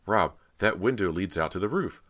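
loud, lifted, excited; urgent cry. A narrator's reading. Cody tells Rob of an escape, excited cry. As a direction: call it out with sudden hope. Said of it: "she cried".

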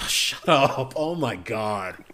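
ordinary talk

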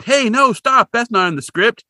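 high, loud cry of protest; mock alarmed shout. Podcaster acting director Maxwell halting the stunt, high mock shout. Retold director calling a halt.

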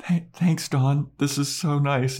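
lightly hammed-up, overcome with feeling; mock choked-up. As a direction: act touched, with a sigh and a stumble. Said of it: "why are you getting all emotional".

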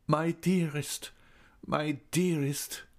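slow, high, aching moan. A narrator's reading. Raoul seeing Christine's ruined face, moaning slowly. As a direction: moan her name in grief. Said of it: "he moaned".

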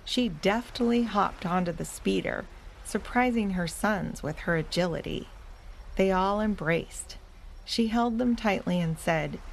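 plain reading voice

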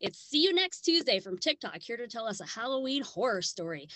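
ordinary talk, nothing marked

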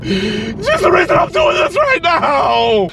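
slow high wailing shout; anguished wail. Acted. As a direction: wail it out, heartbroken. Heartbroken outburst in the car.

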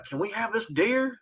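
small, high-pitched imitated question; imitated workers' request. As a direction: ask softly and high, mimicking the workers. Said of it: "the workers actually came over ... were like".

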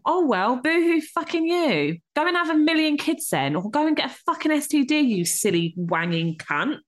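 high, heated rant; swearing tirade. Real, not acted. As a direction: let rip at him. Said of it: "[name]'s a bit angry today".

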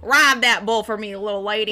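mock cowboy. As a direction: play the rodeo cowboy, hammed-up. Mock cowboy rodeo bit.

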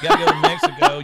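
laughing loudly. Real, not acted. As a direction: joke through a big laugh. Laughing while joking.

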